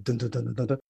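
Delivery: soft low thumping dun dun beats; quiet heartbeat sounds; imitated pounding heartbeat. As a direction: mimic a heart pounding, hushed. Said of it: "My heart's like".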